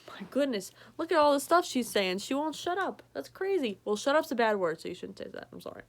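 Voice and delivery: ordinary delivery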